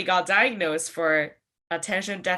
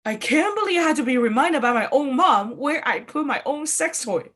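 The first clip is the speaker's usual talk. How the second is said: normal talking voice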